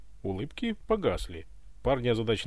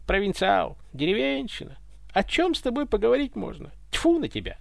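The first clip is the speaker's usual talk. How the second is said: higher-pitched, disdainful put-down; scornful berating; 7 semitones higher than usual. Acted. Tsar berates provincial Ivan, scornful. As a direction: sneer at a hopeless bumpkin.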